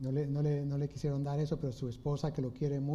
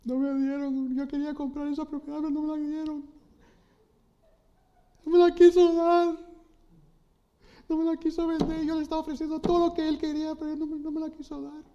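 high, whining spoiled-king voice, louder on the middle line; whiny tantrum; 13 semitones higher, 6 dB louder, faster than usual. Retold or imitated. King Ahab sulking on his bed, high childish whining tantrum. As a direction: sulk and wail like a child denied a toy. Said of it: "Se pone a, a hacer berrinche".

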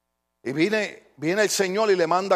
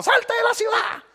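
mock shout, much higher; retold shout. People yelling at the pastor's smoking car, mock shout. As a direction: yell it like people on the street did. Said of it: "que me gritaban".

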